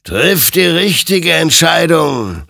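loud, high, drawn-out warning; stern admonishment. A narrator's reading. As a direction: admonish him in a ringing, slow call. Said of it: "ermahnte ihn sein Gegenüber".

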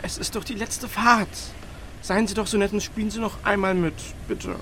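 softly imploring; pleading. Acted. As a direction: beg a small favor. Pleading with her to play along.

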